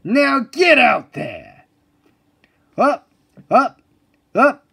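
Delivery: loud, slow drill orders; barked commands. Acted. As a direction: bark it like a sergeant.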